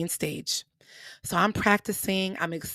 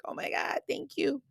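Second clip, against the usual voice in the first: breathy whisper of relief; 6 semitones higher, 6 dB quieter than usual. Retold or imitated. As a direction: whisper it, stunned and grateful.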